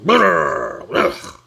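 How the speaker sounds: loud shuddering brrr then ugh; jittery caffeine growl. Retold or imitated. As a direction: shake it off like too much coffee. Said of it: "So I'm like".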